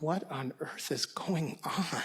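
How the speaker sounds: slow whispered inner thought; frightened whisper. Retold or imitated. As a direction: breathe the thought out in a scared whisper. Scared boy's inner thought.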